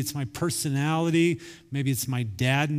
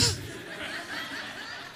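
laugh; 10 dB quieter than usual. Real, not acted. Laugh after the punchline.

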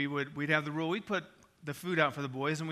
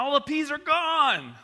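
high, loud, drawn-out astonishment; amazed discovery; 10 semitones higher, 9 dB louder, slower than usual. Retold or imitated. Dad telling of finding the peas gone, amazed. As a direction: exclaim it slowly, pitched way up. Parent feigns discovery of empty tray.

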